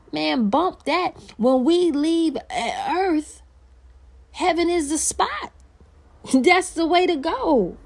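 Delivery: high, slow, exultant preaching; excited. Real, not acted. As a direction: lift the voice in joy about heaven. Preacher exults about heaven.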